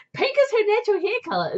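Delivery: high, loud burst of disbelief; astonished. Real, not acted. Reader learning pink hair is natural, astonished.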